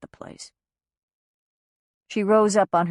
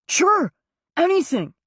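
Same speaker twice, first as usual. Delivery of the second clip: higher, pleading voice of a grown son; pleading young son; 13 semitones higher, 4 dB louder than usual. Acted. Son begs mother not to judge the mess, pleading. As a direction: beg her to believe him.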